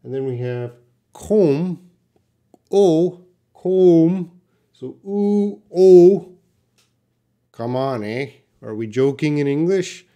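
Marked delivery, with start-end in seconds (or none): 1.1-4.3 "comb" with a long O and silent B, louder; long-O demo. Shown as a demonstration. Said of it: "the B is silent". 5.1-6.3 "oo" then "O", high and distinct; vowel contrast demo. Shown as a demonstration. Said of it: "this O has a oo sound".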